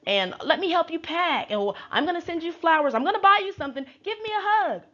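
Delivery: mock over-friendly, very high voice; high-pitched gushing offers, put on; Sutton. Acting out Sutton making nice.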